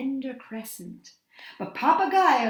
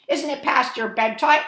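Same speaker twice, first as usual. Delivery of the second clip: teasing parrot; 3 semitones higher, 6 dB louder than usual. A narrator's reading. Parrot teasing night creatures.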